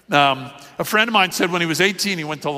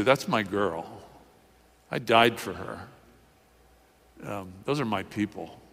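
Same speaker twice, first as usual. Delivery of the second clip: low, soft, tender claim; quiet devotion; 6 semitones lower, 7 dB quieter than usual. Acted. Jesus speaking up for his bride the church, quietly tender. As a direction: claim her softly, with pauses between lines.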